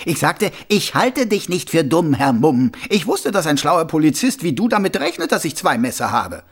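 high, loud mocking voice; a smug killer playing innocent; sneering villain. A narrator's reading. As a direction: Carcer mocking the watchman. Karzer taunts.

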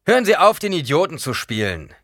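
laut und hoch dazwischenfahren; fällt dem Mann energisch ins Wort; scharfes Unterbrechen. A narrator's reading. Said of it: "unterbrach Paul den Mann". (Paul fällt dem Mann laut ins Wort)

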